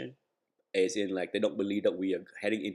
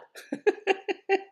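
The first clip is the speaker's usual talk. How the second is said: high laugh. Real, not acted. Laughs at own joke.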